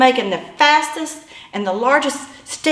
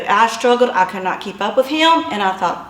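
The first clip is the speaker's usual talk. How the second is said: usual speaking voice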